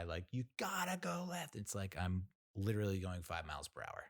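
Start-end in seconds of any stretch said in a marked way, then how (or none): 0.3-1.6 high-pitched emphatic direction guy. Retold or imitated. Volunteer direction guy to a crawling driver, emphatic. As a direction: put on a high, insistent voice. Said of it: "then the emphatic direction guy".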